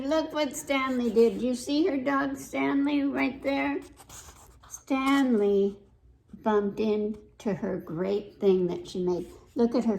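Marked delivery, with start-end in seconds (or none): none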